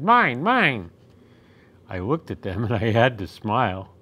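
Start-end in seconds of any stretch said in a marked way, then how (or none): 0.0-0.9 high, louder odd little troll voice; greedy troll squabble, insistent; clamoring trolls. A narrator's reading. Trolls grabbing the presents, greedy high clamor. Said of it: "they clamored".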